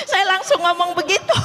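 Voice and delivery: high, laughing confession; amused, laughter breaking into the words. Real, not acted. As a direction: say it through real laughter. Laughing at what she said.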